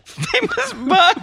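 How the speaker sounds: slow, high, giggling rise on Buck; laughing callback. Real, not acted. Host bringing back the famous Buck joke, laughing. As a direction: savor the joke through a laugh.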